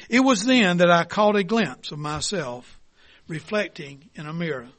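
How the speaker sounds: ordinary talk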